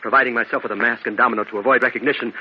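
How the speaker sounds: ordinary delivery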